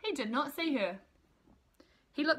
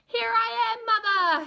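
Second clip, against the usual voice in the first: much higher and louder, calling to the sky; 13 semitones higher, 8 dB louder than usual. Acted. Baby bird calls up to the plane, loud and high. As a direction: call up to a plane far overhead. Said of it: "he called out".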